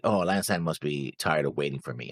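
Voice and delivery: usual speaking voice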